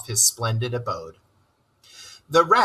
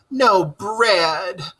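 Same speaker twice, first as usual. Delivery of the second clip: high, louder mournful groan; a groaning complaint; 7 semitones higher, 3 dB louder than usual. Acted. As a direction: groan it in dismay. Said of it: "groaned the mole".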